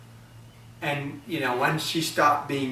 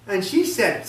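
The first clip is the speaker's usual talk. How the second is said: usual speaking voice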